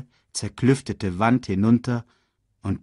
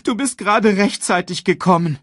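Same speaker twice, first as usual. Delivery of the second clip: high, tearful relief; plaintive high young voice; sobbing child; 9 semitones higher, 4 dB louder than usual. A narrator's reading. Simba thanks his father for rescue, sobbing. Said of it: "schluchzte Simba".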